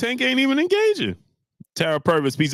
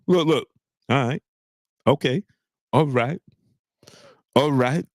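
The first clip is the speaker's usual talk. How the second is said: ordinary delivery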